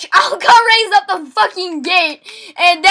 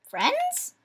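high mock plea; 10 semitones higher, 13 dB quieter than usual. Higher repeat of plea.

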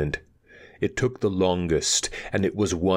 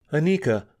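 calling her name; 10 semitones higher, faster than usual. A narrator's reading. Narrator calls at door.

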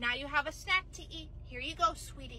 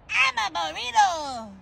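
higher odd little creature croak; creepy creature voice; 3 semitones higher, 8 dB louder, slower than usual. Acted. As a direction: speak like a weird tiny critter. Strange creature speaks.

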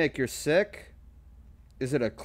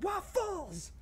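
very high squealed waffles; 4 dB quieter than usual. Pretend. Song ending shout.

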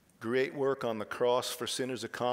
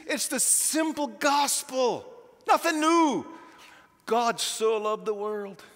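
louder and higher, driving toward a shout; impassioned preaching; 8 semitones higher, 5 dB louder than usual. Acted. Preacher pressing the simple gospel on the congregation, impassioned preaching. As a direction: proclaim it like the climax of the sermon.

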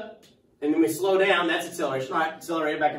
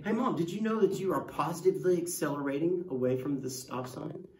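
mock know-it-all kid; 6 dB quieter than usual. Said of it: "you could say".

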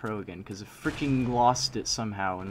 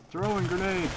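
shouted callout, pitched up; grenade warning yell; 8 semitones higher than usual. Real, not acted. Calls out grenade in match.

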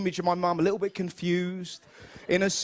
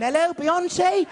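slow, higher delighted phone greeting; mock mum on phone; 7 semitones higher, 4 dB louder, much slower than usual. Mum pretending [name] is calling, slow and high. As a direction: drag out the hello like a starstruck mum.

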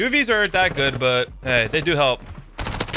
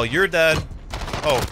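ordinary talk

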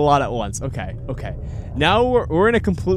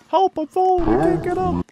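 very high, hammed-up distress voice; mock cry for help; 4 dB louder than usual. Joking about life alert to screaming souls, mock-helpless. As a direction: squeal it like a fallen person calling for rescue.

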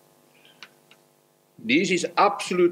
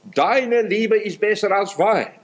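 higher and slower, deine stressed; raised, unhurried verse quote; verse quoted higher; 6 semitones higher, slower than usual. Acted. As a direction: lift the line up high and lean on deine. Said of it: "laut Hohelied, Kapitel eins und Vers zwei".

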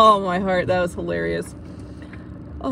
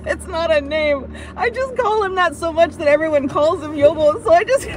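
higher, words breaking through laughter; giggling through the explanation; still laughing; 6 semitones higher than usual. Real, not acted. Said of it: "I'm laughing too hard".

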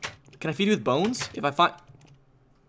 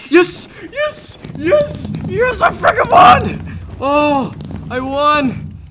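high, screamed triumph; ecstatic victory; 11 semitones higher, 6 dB louder, much slower than usual. Real, not acted. Player winning after hours of trying, ecstatic. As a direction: scream with joy at finally winning. Wins the game.

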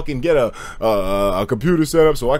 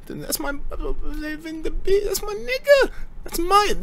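excited, pitched way up; high proud excitement; 13 semitones higher, 5 dB quieter, slower than usual. Real, not acted. Proud of his friend.

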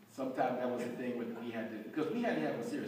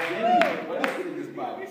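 high, loud, laughing amazement, quickly; amazed wife; 9 dB louder, faster than usual. Retold or imitated. Wife asking her husband, amazed and laughing. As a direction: she can't believe her husband changed.

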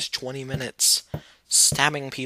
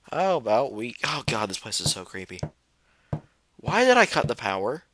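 ordinary talk, nothing marked

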